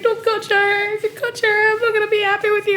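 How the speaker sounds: high whiny imitation of mom crying; mock crying mom. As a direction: whine it like a fake-weepy mother. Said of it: "she tried to blackmail me by crying".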